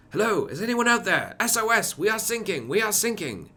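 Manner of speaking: high, loud desperate SOS call; distressed British sailor. Retold or imitated. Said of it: "it's going like".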